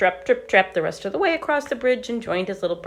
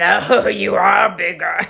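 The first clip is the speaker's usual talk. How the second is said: slow, loud troll roar; menacing troll shouting at the goat; greedy troll; 3 semitones higher, 8 dB louder, much slower than usual. Acted. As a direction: bellow it slowly like a hungry troll.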